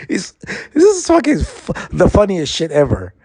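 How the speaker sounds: high, squeaky words straight out of a real laugh; talking through laughter. Real, not acted. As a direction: barely get it out for laughing. Cracking up at own story.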